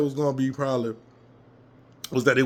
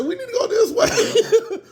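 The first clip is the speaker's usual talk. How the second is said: much higher, slow yell with a laugh; 4 dB louder, slower than usual. Retold or imitated. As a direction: holler the direction while cracking up. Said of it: "I said".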